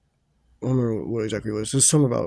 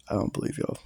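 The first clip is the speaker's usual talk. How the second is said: imitated under the breath, softer and voiceless; disbelieving; 8 dB quieter, faster than usual. Dad eyeing the boys, disbelieving. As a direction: act out a hushed skeptical aside. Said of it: "kind of look".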